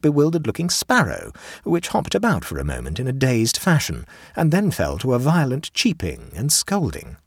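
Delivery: ordinary delivery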